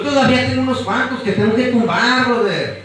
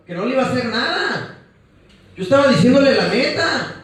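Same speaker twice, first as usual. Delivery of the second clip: ordinary delivery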